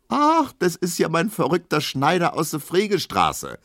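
hoch gerufen, leicht verstellte Stimme; rufen. A narrator's reading. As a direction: ein Gast erkennt den Schneider und ruft es hoch. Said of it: "ruft ein anderer". (anderer Gast, hoch gerufen)

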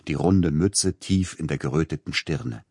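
usual speaking voice

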